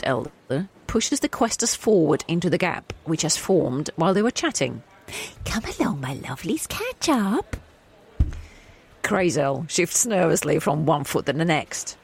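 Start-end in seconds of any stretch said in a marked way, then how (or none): 5.5-7.6 elder's voice. Acted. Elder calling the questers along.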